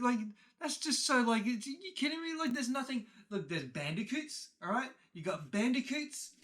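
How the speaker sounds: high, loud, sputtering mock outburst; short-tempered dad. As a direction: imitate an exasperated dad ranting in disbelief. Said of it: "short temper of him goes".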